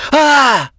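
short high cry of shock; loud, startled yell; shocked shout. A narrator's reading. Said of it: "shouted with shock".

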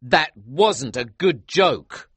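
slow, loud angry protest; cross and emphatic. A narrator's reading. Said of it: "I said angrily".

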